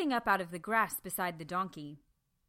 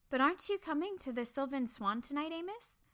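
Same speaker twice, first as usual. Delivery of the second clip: put-on higher young voice; young Bertie, higher and questioning; young girl asking; 7 semitones higher than usual. A narrator's reading. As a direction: ask him with a girl's curiosity. Bertie asks Amos.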